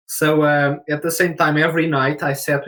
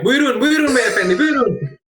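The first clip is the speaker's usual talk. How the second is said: high, loud Turkish street vendor call; Turkish accent; 12 semitones higher, 5 dB louder than usual. Retold or imitated. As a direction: hawk pistachios in a Turkish accent. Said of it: "I use the Turkish accent".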